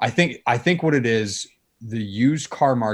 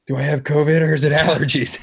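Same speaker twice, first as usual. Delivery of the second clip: mock worried; 6 semitones higher, 5 dB louder, faster than usual. Mocking people worried about covid.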